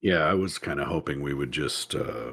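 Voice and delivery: low, soft, reluctant admission; sheepish reluctance. Real, not acted. Host hoping to skip the pairing, sheepish and quiet. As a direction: shrink back quietly from the question.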